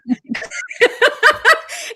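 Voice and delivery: high, loud laugh; helpless high cackle; burst of laughter. Real, not acted. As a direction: let out a big high-pitched laugh. Laughs at the retold answer.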